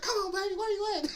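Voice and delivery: very high comic holler; hammed-up pleading imitation; Tracy. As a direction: call through a locked door in a high voice. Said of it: "he's back at the house pounding on the door".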